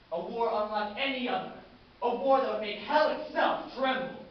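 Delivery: loud oratory building to a high shout; rousing war cry. Acted. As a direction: thunder it out to the battalion. Commander demands a massive war.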